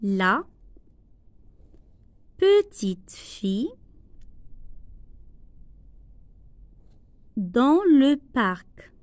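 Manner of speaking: slow, word by word dictation; careful spaced dictation. Shown as a demonstration. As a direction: read it out slowly for the class to write. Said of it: "teacher dictates slowly".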